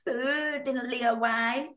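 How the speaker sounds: high sweet voice talking to your body; mock tender talk to body. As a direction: coo at your own body like a pet. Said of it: "you have to have a chat with it".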